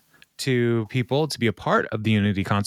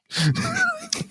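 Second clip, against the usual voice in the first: high laugh. Real, not acted. Laughing at own Craig impression.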